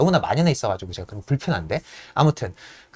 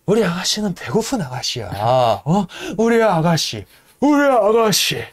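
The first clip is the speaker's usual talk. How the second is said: slow, higher mock stoker voice; Yank impression; 4 semitones higher than usual. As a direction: drag it out like a proud stoker. (느리고 높은 양크 흉내)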